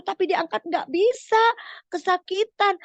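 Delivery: high, loud storytelling alarm; urgent, heightened narrator; dramatic narration. A narrator's reading. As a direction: raise the stakes for young listeners. Narrator dramatizes the failed rescue.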